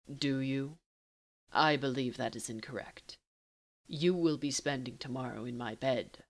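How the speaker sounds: low and unhurried, taunting a lover; teasing Castiel. Acted.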